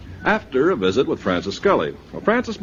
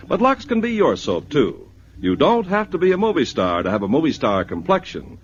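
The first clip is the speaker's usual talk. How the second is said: ad read; 4 semitones higher than usual. Acted. Host pitching Lux soap to the ladies, upbeat ad read.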